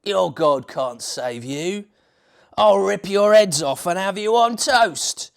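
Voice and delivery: slow then loud Cockney threat; menacing Cockney giant. Acted. Goliath threatening God's people in loud Cockney. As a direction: threaten like a brute.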